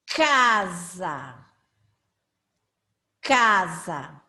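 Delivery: "Casa" slowly, with a hard, clear "k" start; emphasised initial K. Shown as a demonstration. Teacher giving examples of C sounds, emphatic "k" in "Casa". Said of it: "você vai perceber esse som".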